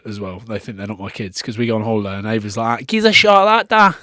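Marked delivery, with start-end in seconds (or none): 2.9-4.0 loud, high mimicry of a Scottish-accented child; daughter's Scottish accent. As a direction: call to dad in broad mock Scottish. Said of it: "they've all got Scottish accents".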